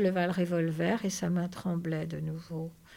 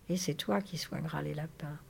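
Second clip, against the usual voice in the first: softer, resigned repetition; subdued echo; 3 semitones lower, 7 dB quieter than usual. Acted. George echoes Lénie's dream back, subdued. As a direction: repeat it back quietly.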